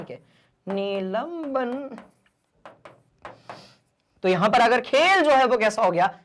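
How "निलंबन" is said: very slow, softer "निलंबन", syllables stretched; drawn-out term. Shown as a demonstration. Teacher repeats key term slowly.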